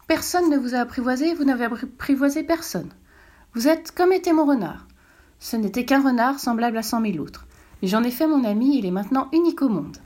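a hint of a child speaking up; little prince voice.

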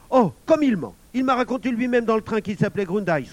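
put-on high, loud boyish indignation; indignant protest. A narrator's reading. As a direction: protest the lie with outrage. Émile indignantly calls thief a liar.

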